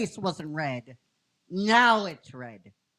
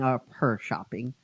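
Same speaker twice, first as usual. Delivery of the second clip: ordinary delivery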